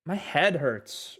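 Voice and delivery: My head hurts said at half speed, each word clear; slow demo. Shown as a demonstration. Teacher modelling My head hurts slowly for Spanish speakers. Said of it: "Me duele la cabeza".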